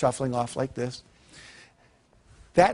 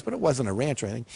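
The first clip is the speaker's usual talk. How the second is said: ordinary talk, nothing marked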